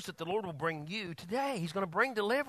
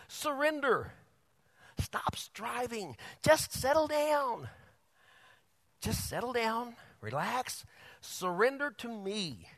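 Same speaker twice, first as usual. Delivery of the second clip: calm gentle God voice; 2 semitones higher, 4 dB quieter, slower than usual. Acted. God's voice, calming the listener.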